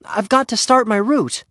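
put-on high, urgent boy's plea; pleading. A narrator's reading. Henry needs out to start his route, pleading. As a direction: press your case, pleading. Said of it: "he pleaded".

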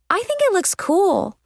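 high-pitched, admiring declaration; enthusiastic declaration. A narrator's reading. Friend admiring the nose ring, enthusiastic declaration. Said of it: "declared Mal".

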